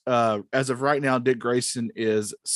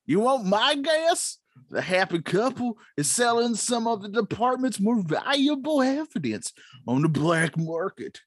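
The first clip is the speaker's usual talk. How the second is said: put-on old prospector; 8 semitones higher than usual. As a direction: play Gordon as a grizzled old prospector. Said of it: "the part of Gordon will be played by the old prospector".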